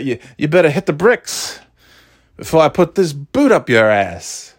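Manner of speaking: lightly voiced tough guy threat; a hint of a drawling vampire tough guy; Jackie Daytona line. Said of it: "I did a couple lines from the show".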